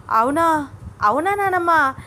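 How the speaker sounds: high, loud burst of delight; excited, disbelieving questions; thrilled. A narrator's reading. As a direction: react as if about to jump for joy. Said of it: "చిన్నగా ఎగిరి గంతులు వేస్తున్నంత".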